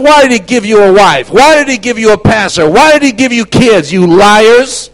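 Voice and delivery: loud, high, scolding preacher; shouted rebuke. Retold or imitated. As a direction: berate the congregation at full volume. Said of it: "I started talking like this".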